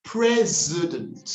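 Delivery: president drawn out slowly with a Z; corrected pronunciation. Shown as a demonstration. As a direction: model president slowly as PREZ-uh-dunt. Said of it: "That S will turn into a Z".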